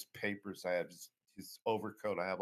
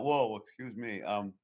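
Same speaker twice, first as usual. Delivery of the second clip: ordinary talk, nothing marked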